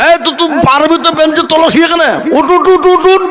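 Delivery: high, fast calls of get up; excited urging. Acted. Teacher hurries the boy out from under the bench, high and fast.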